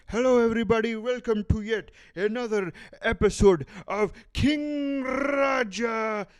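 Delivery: high, loud hammed-up Indian accent, calling out to the camera. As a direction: open the show like a host with a thick Indian accent. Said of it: "I fucking love the accent".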